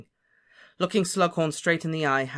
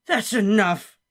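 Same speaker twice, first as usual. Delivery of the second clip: much higher, louder cut-off; sudden outburst; 8 semitones higher, 9 dB louder, slower than usual. Acted. Slughorn suddenly stopping Harry's story. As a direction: snap it out abruptly. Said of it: "said Slughorn suddenly".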